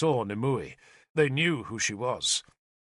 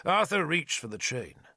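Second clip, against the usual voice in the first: ordinary talk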